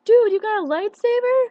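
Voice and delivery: high, loud, slowed-down awe; excited friend. Retold or imitated. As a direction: gasp it out like an amazed buddy. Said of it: "and Cameron was like".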